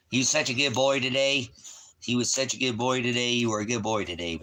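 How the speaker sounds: high, loud praise for a dog; doting pet talk. Real, not acted. Owner praising the dog for being good today, doting pet talk.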